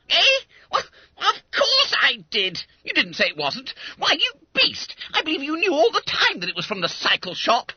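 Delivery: very high, loud schoolboy squawk; indignant protest. Acted. Fat schoolboy to the chum who fooled him, indignant. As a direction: squeal in outrage at being tricked.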